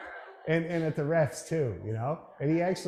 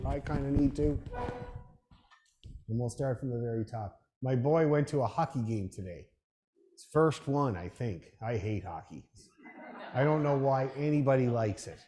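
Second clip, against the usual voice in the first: usual speaking voice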